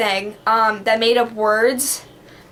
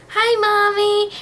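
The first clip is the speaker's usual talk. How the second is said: put-on high, slow little girl voice; playing her small self on a toy phone; little kid voice; 9 semitones higher, slower than usual. Imitating her child self chirping to mom.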